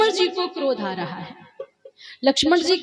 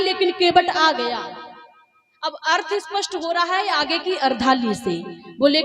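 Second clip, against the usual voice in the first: ordinary delivery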